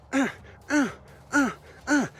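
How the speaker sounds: high straining grunts; monkey straining grunts. Retold or imitated. Monkey straining to pull hand.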